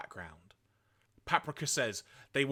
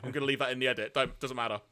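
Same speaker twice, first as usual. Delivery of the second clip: ordinary delivery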